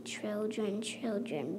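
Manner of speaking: usual speaking voice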